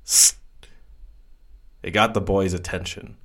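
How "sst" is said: sharp scolding hiss through teeth. A narrator's reading. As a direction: hiss to get his attention. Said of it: "a cat-like hiss that was part whisper, part reprimand".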